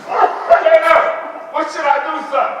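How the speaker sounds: high, loud, frantic panic; panicked. Acted. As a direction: panic as he collapses. Father panics as son collapses.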